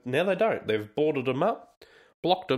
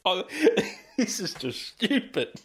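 laughing; 8 semitones higher than usual. Real, not acted. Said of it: "I shouldn't be laughing".

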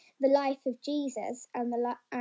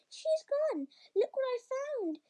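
put-on excited boy; 10 semitones higher, 3 dB quieter than usual. A narrator's reading. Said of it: "said the boy".

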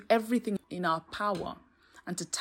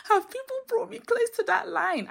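much higher and louder, "Have" exclaimed; exasperated exclaim; 14 semitones higher, 7 dB louder than usual. Real, not acted. Podcaster confessing to listeners: loud high exclaim. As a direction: belt the question out in mock despair. Whispered then repeated loudly.

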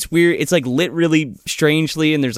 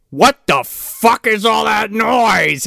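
slow, higher, hammed-up drunk dad; angry dad voice; 9 semitones higher, 4 dB louder, slower than usual. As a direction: bark it like a grumpy father. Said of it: "and he's like".

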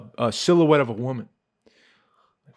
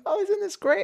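very high sing-song mock voice; imitated cheery relative; delighted aunt. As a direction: gush obliviously like an amused aunt. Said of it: "my aunt's like".

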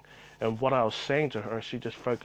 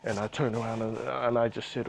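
ordinary talk, nothing marked

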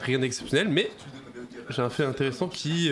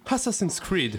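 higher-pitched, put-on English accent; playful English accent, pitched up; mock English accent; 7 semitones higher, slower than usual. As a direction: announce the title like an Englishman. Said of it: "Je suis anglais".

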